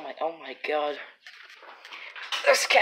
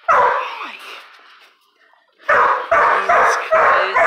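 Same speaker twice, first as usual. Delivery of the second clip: high, very slow breathy Jesus Christ; drawn-out stunned exclamation; shocked gasp; 6 dB louder, much slower than usual. Real, not acted. Shocked at cat with chipmunk.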